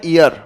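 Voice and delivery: ईयर begun with a plain long "ee" vowel; common mispronunciation. Shown as a demonstration. Teacher showing how most people say "year", ईयर with a bare "ee" onset. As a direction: say ईयर as "ee-ar", with no y sound at the front. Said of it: "हम लोग इसको बोलते हैं".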